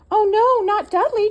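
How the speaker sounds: high, loud shocked protest; crying out. Acted. Trevor the turkey protesting the accusation, crying out. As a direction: cry out high and loud in dismay. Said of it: "cried Trevor".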